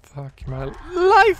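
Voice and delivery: high, loud, drawn-out scream of panic; panicked scream. Real, not acted. Player spots the monster right behind him, screaming in panic. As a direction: scream it out in real fright.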